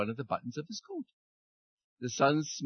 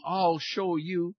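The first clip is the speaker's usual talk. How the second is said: even slower, more stretched-out menace; slower menacing threat; 4 semitones higher, much slower than usual. A narrator's reading. Wind repeating its vow to the sun, slower menace. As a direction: drag it out like a rising gale. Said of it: "roared the wind".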